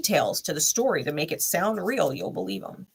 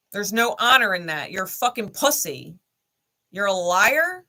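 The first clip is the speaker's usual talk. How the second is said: angry rant; 4 dB louder than usual. Real, not acted. Ranting loudly at the ex who lied to her. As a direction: pound on honor, furious and loud.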